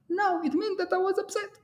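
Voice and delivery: high, soft mock answer; high comic reply. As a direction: answer it in a squeaky put-on voice. Answering his own rhetorical question.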